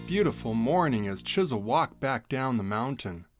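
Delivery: ordinary delivery, nothing marked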